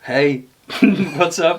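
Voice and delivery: slow, awkward laughing hello; a laughing, hesitant hey; awkward greeting. Retold or imitated. Fan to his idol, awkwardly says hello, laughing. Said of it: "Kinda like".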